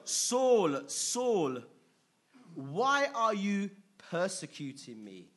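voice of the Lord. Acted. Preacher voicing Jesus confronting Saul, loud and slow. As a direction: ring it out slowly like God confronting him.

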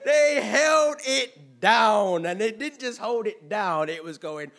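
loud, high, stretched-out words; loud exaggerated drawl on each word; drawn-out exasperation. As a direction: drag it out like the endless horn. Acting out an endless horn.

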